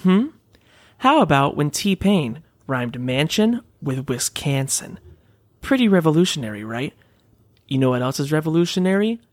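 ad read. Acted. Reads a sponsor ad.

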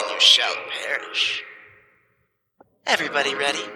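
magically amplified voice from above; booming voice. Acted. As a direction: boom it down from overhead like a god. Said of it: "you hear a booming voice come from overhead".

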